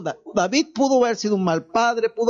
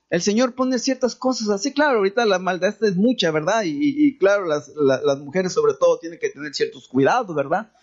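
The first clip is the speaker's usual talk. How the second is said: usual speaking voice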